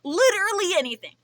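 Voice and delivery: high, loud insistence; loud emphatic. Real, not acted. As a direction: push it out loud. Emphatic offer.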